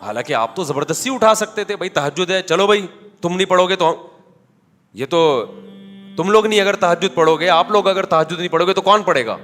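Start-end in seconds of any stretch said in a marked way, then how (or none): none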